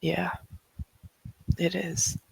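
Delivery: hushed, tender reassurance; soft, gentle. Acted. Sebastian tells the boy he can go to her, softly. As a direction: reassure softly, trailing into near whisper.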